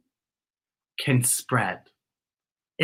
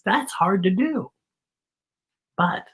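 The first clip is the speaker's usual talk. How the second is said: plain reading voice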